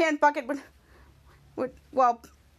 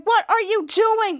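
slow, high, emphatic demand; put-on scolding question; acted exasperation; 6 semitones higher, 4 dB louder, much slower than usual. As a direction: draw out each word in mock disbelief. Kid Danger confronting the others.

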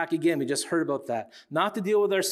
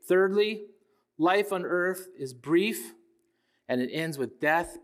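normal talking voice

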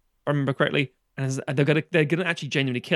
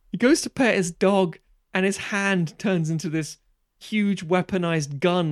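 ordinary talk

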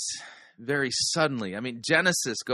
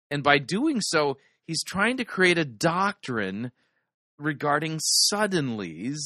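ordinary talk, nothing marked

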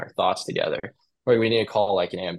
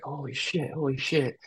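awed disbelief; 5 semitones higher, 4 dB quieter than usual. Retold or imitated. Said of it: "I was like".

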